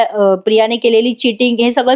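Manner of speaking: normal talking voice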